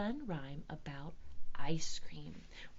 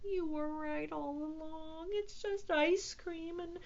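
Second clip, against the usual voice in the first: high, slow, building to a wail; confess, then wail and trail into a drawn-out apology; tearful little cat; sad admission; 8 semitones higher, 5 dB louder, slower than usual. A narrator's reading. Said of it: "he admitted sadly".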